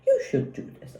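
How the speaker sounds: high, whispered prissy bossing; prim mock voice. Mocking the prim wife bossing her husband, high whisper. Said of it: "prim and proper and analytical".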